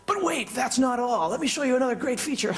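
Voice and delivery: high, fast pitchman voice; TV huckster. Retold or imitated. Impersonating a TV huckster selling a feature. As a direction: sell it like a late-night infomercial. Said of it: "these hucksters on T V that are like".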